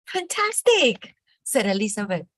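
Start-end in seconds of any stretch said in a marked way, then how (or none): 0.1-1.1 drawn-out, much higher, louder cry of delight; thrilled praise. Acted. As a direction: gush over the dragon's fire, slowly. Elizabeth praises the fire-breathing dragon.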